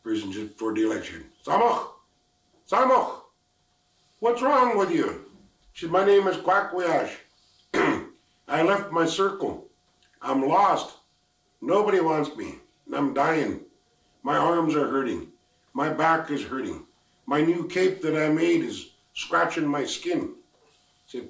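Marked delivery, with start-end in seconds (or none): 1.4-5.3 high, loud call from afar; a high, loud, drawn-out call. Acted. Voice calls the lost girl. 5.9-20.3 high, loud, pleading complaint; a mild, high wail of woes; lost girl lament. Acted. Lost girl pours out troubles.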